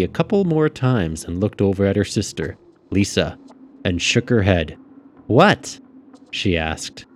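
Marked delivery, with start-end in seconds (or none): 5.3-5.8 put-on high girlish questioning. A narrator's reading. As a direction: toss back a high, rising question as a girl. Girl answers her sister back.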